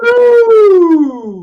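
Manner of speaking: high, loud drawn-out hello; Seinfeld hello. Pretend. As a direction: sing out a big hello.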